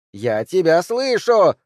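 high, loud, slow piercing cry; shrill shout. A narrator's reading. Woman shrieks at the fleeing man. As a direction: shriek it after him. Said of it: "пронзительно закричала Ханна".

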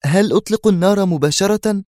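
eager, high-pitched firm query; firm question. A narrator's reading. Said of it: "وهو يسأل في حزم".

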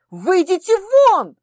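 a high, loud, slow order to get out; furious shout. Acted. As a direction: throw them out at full voice. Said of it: "воскликнула, не сдержавшись".

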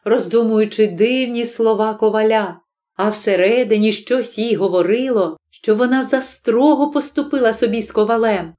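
ordinary delivery

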